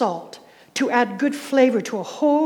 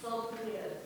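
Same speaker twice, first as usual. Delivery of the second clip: barely voiced, much softer whisper; 19 dB quieter, faster than usual. Real, not acted. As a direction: breathe the phrase out as a whisper. Repeating the congregation's answer.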